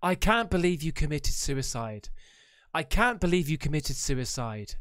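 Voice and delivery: low-pitched mock-dramatic line reading; imitating bad acting. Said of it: "This is bad acting".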